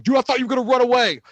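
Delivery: high, loud, fast brother impression; acted-out incredulous sibling; imitated teasing brother. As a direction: blurt it high and quick, as the brother. Imitating brother on his return.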